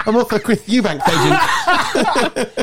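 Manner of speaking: high, slow words through a laugh; giggling through the joke. Real, not acted.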